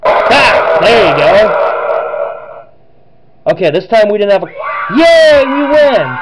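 shouting with joy at the win; excited cheering. Real, not acted. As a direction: cheer it out loud, real triumph. Winning the game, cheering.

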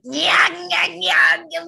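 loud, high snarling nya nya like a starving creature; angry clawing gnome. Shown as a demonstration. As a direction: tear into it like a ravenous clawed gnome. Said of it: "I'm not a gnome inside going".

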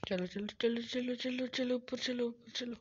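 high, repetitive chant of urging; rising, anxious go-go-go; nervous urging. Real, not acted. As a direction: chivvy yourself along in a high voice. Nervously urging self upward.

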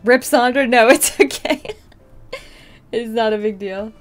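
ordinary talk

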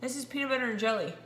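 usual speaking voice

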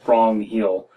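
usual speaking voice

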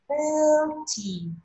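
"forty" with FOR high and held long, TY dropping; slow drill of "forty", high first syllable; first-syllable stress. Shown as a demonstration. Teacher drilling first-syllable stress, long high FOR in "forty". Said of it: "Trọng âm nhấn đầu tiên".

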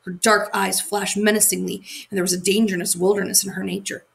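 plain reading voice

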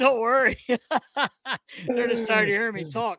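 laughing through the words; slow, laughing tease. Real, not acted. Laughing while teasing.

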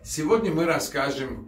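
usual speaking voice

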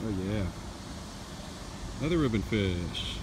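ordinary delivery

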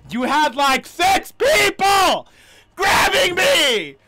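high full-volume rage, words stretched out; screamed accusation. Real, not acted. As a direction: scream it slowly in sheer outrage.

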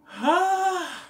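sudden high, loud shriek of shock. Retold or imitated. Imitating her shocked reaction, a high loud shriek. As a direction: scream like you just saw him transformed. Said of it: "I was like".

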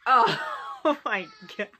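loud burst of laughter; laughing. Real, not acted. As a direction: crack up laughing. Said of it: "see why I'm laughing right now".

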